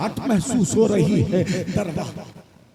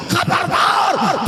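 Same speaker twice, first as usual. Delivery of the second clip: खबरदार stretched slow, much higher and louder; drawn-out warning shout; 11 semitones higher, 4 dB louder, much slower than usual. Acted. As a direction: hurl खबरदार as a long piercing warning. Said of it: "लड़की ने कहा". (खबरदार को खींचकर ऊँचा चिल्लाना)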